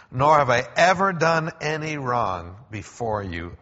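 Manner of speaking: loud, slow, ringing declaration; Daniel calling up to the king, loud and triumphant; proclaiming reply. Acted. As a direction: call it up out of the pit with conviction. Daniel answers from the den.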